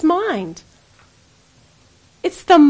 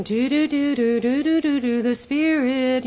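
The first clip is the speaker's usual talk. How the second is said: sing-song mock séance chant; mock spooky spirit. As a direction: make fun of conjuring up spirits. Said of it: "This is not something".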